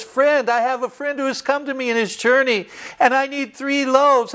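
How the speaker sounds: a friend asking urgently for bread; pleading neighbor. Retold or imitated. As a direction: friend knocking at midnight asking for loaves. Friend begging at midnight door.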